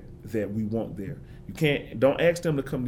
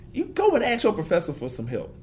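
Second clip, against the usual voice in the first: imitating mom; 8 semitones higher than usual. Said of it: "she don't talk like that".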